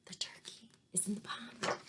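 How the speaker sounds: soft, secret whisper. Real, not acted. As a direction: tell it like a secret. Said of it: "whispered secret to class".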